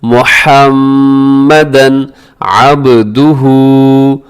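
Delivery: slow, drawn-out recitation; measured teaching chant; tajweed recitation. Shown as a demonstration. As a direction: recite slowly with long held vowels. Recites for learners.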